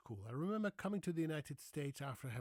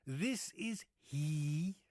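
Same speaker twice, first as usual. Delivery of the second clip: slow, drawn-out formal answer with a rising end; formal phone answer; 4 semitones higher, 6 dB louder, much slower than usual. Retold or imitated. Husband answers the phone the formal American way, slow and stiff. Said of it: "And then you say".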